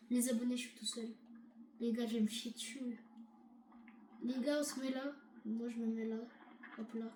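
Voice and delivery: low, soft, subdued voice; hushed confiding. Real, not acted. Player alone tells subscribers he is hiding, hushed. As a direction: keep it down as if hiding.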